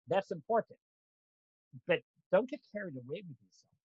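normal talking voice

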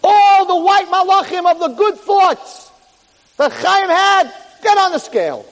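high, loud, drawn-out announcer's call; big slow call to a crowd; yelling down the hall. Retold or imitated. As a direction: holler it across a locker room. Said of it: "they yell down the hall".

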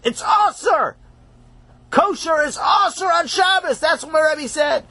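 shouted high and proud. Acted. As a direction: proclaim it triumphantly. Child proudly proclaims the rule.

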